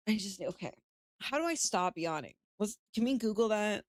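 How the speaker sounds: ordinary delivery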